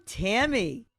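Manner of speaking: normal talking voice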